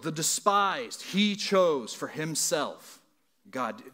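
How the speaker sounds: front-load foolish, weak and lowborn loud, let he chose for himself God fall; Greek-order emphasis demo. Shown as a demonstration. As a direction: stress the opening word of each line hard and drop each line's close. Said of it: "would sound like this".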